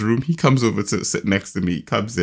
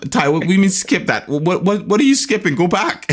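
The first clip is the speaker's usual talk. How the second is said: higher-pitched, incredulous challenge; exasperated father; 8 semitones higher than usual. Retold or imitated. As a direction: retell a dad's baffled correction. Retold father correcting his son.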